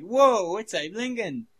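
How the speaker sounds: high, loud acted astonishment; mock amazement. Reacting to Lincoln popping into the podcast, mock amazement. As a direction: burst out as if seeing a celebrity. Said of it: "gonna do something similar".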